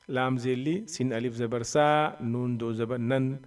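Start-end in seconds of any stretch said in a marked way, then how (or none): none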